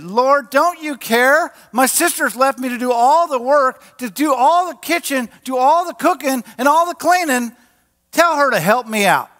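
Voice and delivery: high, worked-up complaint, mock fit; wigging out. Preacher acts out Martha complaining to the Lord, mock fit. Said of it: "Martha's wigging out, throwing a fit".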